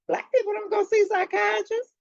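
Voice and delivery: high, flat-out scoffing; incredulous objection. Retold or imitated. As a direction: say it like it's obvious to everyone. Retold objection to doctor.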